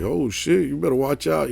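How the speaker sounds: high, soft mock warning; imitating teasing friends. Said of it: "they would make fun of me".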